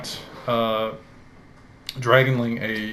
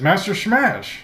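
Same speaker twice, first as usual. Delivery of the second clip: put-on raised voice of an elderly servant, startled; the old retainer calls out his young master; old dragonborn servant; 8 semitones higher, 4 dB louder than usual. Elderly dragonborn greets the young master, startled. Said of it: "looks at you and says".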